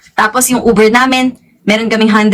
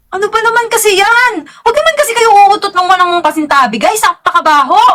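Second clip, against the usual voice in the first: mock-annoyed complaint; 8 semitones higher than usual. Real, not acted. Complaining about a fart smell.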